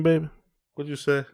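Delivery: ordinary talk, nothing marked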